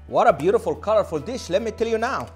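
ordinary talk, nothing marked

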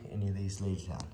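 ordinary talk, nothing marked

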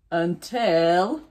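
usual speaking voice

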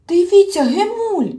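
high, loud excited call; a young troll yelling upward; shouting out. A narrator's reading. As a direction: cry out to the Hemulen for help. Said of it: "вигукнув Мумітроль".

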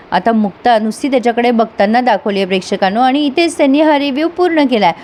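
usual speaking voice

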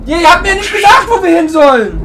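loud frustrated yell; shouting. Real, not acted. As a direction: shout back in annoyance. Yells back at game characters.

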